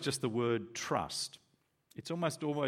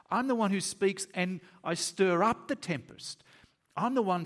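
high, incredulous scoff at a mere storm; lofty boast in a high, emphatic voice; indifferent God, acted; 7 semitones higher, 4 dB louder than usual. Preacher voices God unbothered by a storm, high and dismissive. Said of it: "he's asleep too because he's indifferent".

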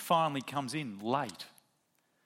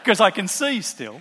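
louder, laughing through it; laughing punchline; 5 semitones higher, 11 dB louder than usual. Real, not acted. Pastor finishing his eye doctor joke, laughing.

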